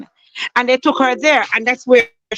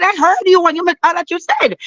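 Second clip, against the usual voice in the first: higher, pushed angry voice; retold angry retort; 8 semitones higher than usual. Grieving mother confronting the doctor, high angry retort. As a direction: throw her words back at her, high and sharp.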